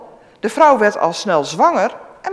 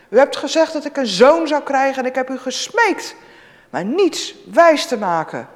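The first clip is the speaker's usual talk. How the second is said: high, loud pleading; grieving mother confronts the prophet; anguished reproach; 7 semitones higher, 6 dB louder than usual. Acted.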